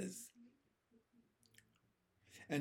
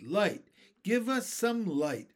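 raised, insistent call; crying out; 5 semitones higher, 4 dB louder than usual. A narrator's reading. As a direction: cry out a demand, pitched up. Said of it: "they cried".

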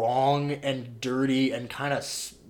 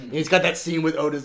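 usual speaking voice

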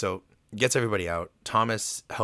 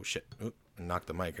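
normal talking voice

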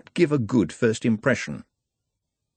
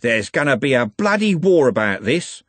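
rough gang-leader threat, pitch raised; menacing; 4 semitones higher, 3 dB louder than usual. A narrator's reading. Andy threatening Trev with cutlass raised, menacing. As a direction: promise trouble, menacingly. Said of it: "Andy hissed and raised his cutlass menacingly".

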